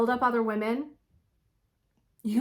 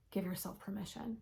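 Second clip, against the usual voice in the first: sudden whisper; 3 semitones lower, 14 dB quieter than usual. Real, not acted. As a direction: whisper give yourself permission, voiceless and much softer. Urgent plea to women viewers.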